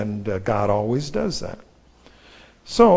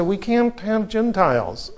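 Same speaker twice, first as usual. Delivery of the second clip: put-on old Peter; 8 semitones higher than usual. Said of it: "The old Peter would have said".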